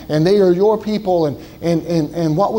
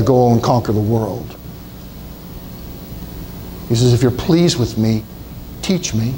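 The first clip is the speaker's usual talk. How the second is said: hushed plea; 5 semitones lower than usual. Acted. Preacher voicing Moses, low and hushed.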